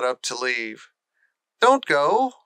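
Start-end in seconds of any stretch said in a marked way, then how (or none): 1.6-2.3 higher, louder plea; pleading. Acted. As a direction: beg her not to leave. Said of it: "pleaded Colin".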